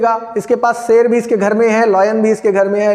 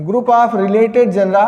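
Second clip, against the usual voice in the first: slow dictation pace; much slower than usual. Shown as a demonstration. Teacher dictating a definition to students, slowly. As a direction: spell out group of related genera word by word. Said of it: "अगर हम definition लिख लें".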